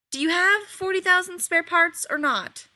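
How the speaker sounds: high, loud, biting sarcasm; Rodney, fed up with Shepherd, asks sarcastically; sarcastic. A narrator's reading. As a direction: sneer the question at him. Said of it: "Rodney asked sarcastically".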